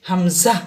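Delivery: হামজা with a glottal push on the first vowel; pushed hamza. Shown as a demonstration. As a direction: give হামজা a sharp throat push. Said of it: "ধাক্কা দিয়ে বলতে হবে". (হামজা ধাক্কা দিয়ে)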